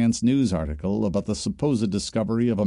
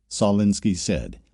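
usual speaking voice

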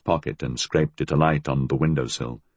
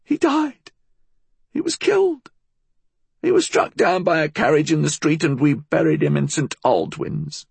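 put-on very high old-woman voice; high, agitated old crone; insistent old woman; 12 semitones higher than usual. A narrator's reading. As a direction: press the account on a doubter.

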